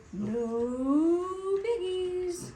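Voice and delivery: very slow, high drawn-out moo voice; lowing cow. A narrator's reading. Cow answers Grinch, mooing slowly. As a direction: moo the words.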